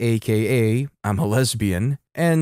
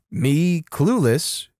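plain reading voice

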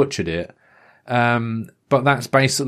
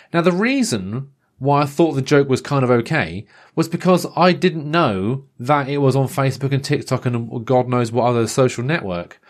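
ordinary talk, nothing marked

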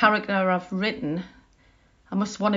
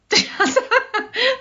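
high cackling laugh; witchy cackle; 4 dB louder than usual. Real, not acted. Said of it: "Sound like a witch now".

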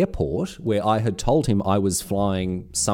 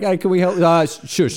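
high, loud, hammed-up exchange; acted interruption; 9 semitones higher, 6 dB louder than usual. Podcast listener acting out kids interrupting him, mock pestering and shushing. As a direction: play the pestering and the brusque shushing.

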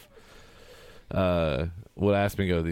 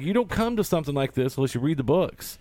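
mild mock yell, pitched high; retold yelling; 7 semitones higher than usual. Fan telling a girl off at a movie, mock yelling. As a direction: lecture a stranger in a raised, higher voice. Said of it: "Why are you yelling at this girl".

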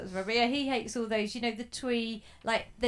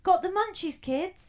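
twee ad voice; 7 semitones higher, 5 dB louder than usual. Retold or imitated. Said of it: "really twee stuff".

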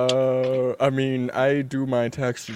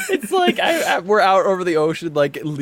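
excited; 6 semitones higher, 4 dB louder, faster than usual. Real, not acted. Excited comic scenario.

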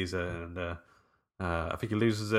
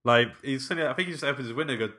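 normal talking voice

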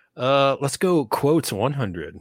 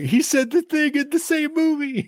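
excited; slower than usual. Retold or imitated. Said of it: "I'm like".

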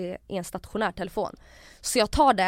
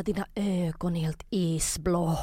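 slow, whispery creepy imitation; drawn-out voiceless mock menace; acted eerie menace; 3 semitones lower, slower than usual. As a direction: whisper it slowly like a mimicked accuser. Retold menacing accusation about eyes.

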